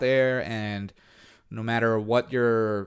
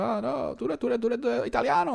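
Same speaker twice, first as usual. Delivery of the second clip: much higher, insistent imitation of a local; mocking local; 12 semitones higher, 3 dB quieter than usual. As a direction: mimic him insisting, voice pitched way up. Local insisting he's Italian, retold.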